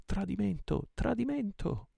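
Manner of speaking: very high alarm cry; yelling. A narrator's reading. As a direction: raise the alarm at a high pitch. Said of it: "risalirono in furia la scala urlando". (urlo d'allarme acuto)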